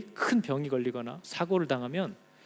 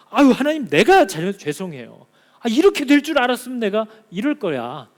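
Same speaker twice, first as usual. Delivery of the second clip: higher, louder apologetic cry; remorseful plea; 5 semitones higher, 8 dB louder than usual. Acted. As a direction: beg forgiveness in a rush of remorse. Believer apologising to God, acted.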